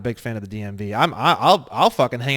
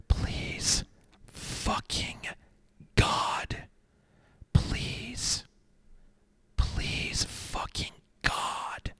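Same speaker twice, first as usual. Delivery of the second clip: softer, breathy whispered pleading, slow; whispered mock prayer; 10 dB quieter, much slower than usual. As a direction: whisper a desperate prayer, tongue in cheek.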